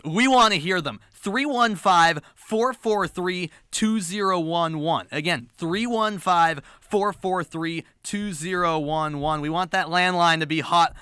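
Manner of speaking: ordinary talk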